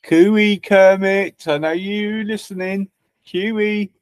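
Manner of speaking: high, drawn-out cooey call. Real, not acted. Streamer hailing Kermit to see who's listening, calling out. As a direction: sing out to get someone's attention.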